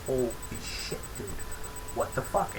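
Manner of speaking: quietly dumbfounded retold reaction; retold hushed disbelief. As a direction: murmur it in disbelief, retelling the moment. Retold stunned reaction to creature.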